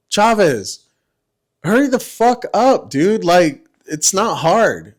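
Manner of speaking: mock exasperation, starting high; retold impatient nagging of a slow subordinate; exasperated. Retold hurrying a slow squad member.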